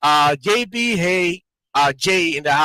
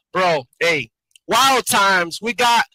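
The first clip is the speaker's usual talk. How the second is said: ordinary talk, nothing marked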